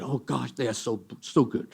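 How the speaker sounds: ordinary talk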